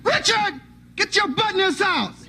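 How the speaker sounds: high, soft mother's call; hollering mom calling her son home. Retold or imitated. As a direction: call the boy in like his mom. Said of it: "I can hear her voice now".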